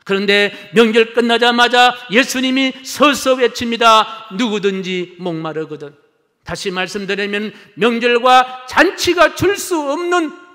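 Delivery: high, loud pulpit proclamation; ringing, urgent sermon delivery; full-force preaching. Real, not acted. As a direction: proclaim it to the whole congregation.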